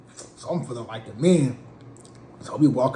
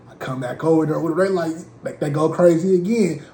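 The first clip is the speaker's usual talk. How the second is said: usual speaking voice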